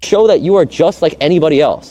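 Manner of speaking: normal talking voice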